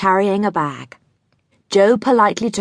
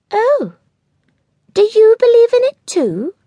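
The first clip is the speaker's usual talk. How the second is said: high, louder astonished question; amazed a grown-up would; surprised; 6 dB louder than usual. A narrator's reading. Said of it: "surprised that a grown-up".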